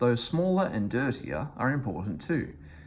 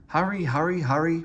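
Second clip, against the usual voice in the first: higher, urgent panting engine; a light, hurried pant; 5 semitones higher, 3 dB louder than usual. A narrator's reading. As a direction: push the words out breathlessly. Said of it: "panted Henry".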